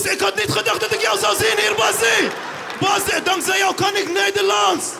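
put-on veel hoger en luider roepen met Turks accent. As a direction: roep Bassie uitgelaten toe met zwaar Turks accent. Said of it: "hoe die Turkse man klonk". (Turkse jongeman roept Bassie met Turks accent)

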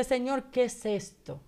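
usual speaking voice

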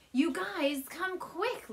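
shouting. A narrator's reading. Little ghost brother shouting for his family to come. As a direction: call them over in a hurry. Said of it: "Little John shouted".

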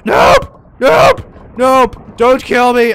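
high, loud frantic screams of nope; panicked yelling. Real, not acted. Player screaming at Mario not to kill them, frantic shout. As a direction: yell in real panic as you're chased.

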